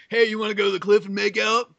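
corny fifties movie come-on; lightly voiced old film romance; stilted old movie melodrama. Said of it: "sounds like a nineteen fifties movie".

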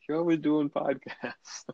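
mock wife's complaint. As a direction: drag it out like the annoyed wife.

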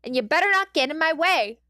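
loud, high bully yell; angry kid shout. A narrator's reading. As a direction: threaten him like a snarling brat. Kacchan threatening.

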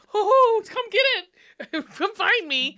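hammed-up spooky lure with a laugh; teasing spooky call.

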